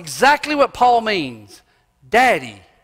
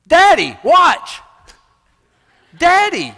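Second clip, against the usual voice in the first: put-on high, loud child shouting for attention; excited child; 10 semitones higher, 8 dB louder, slower than usual. Little kid calling dad to watch, high laughing shout. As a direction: show off to daddy with a laugh. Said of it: "That's what kids do".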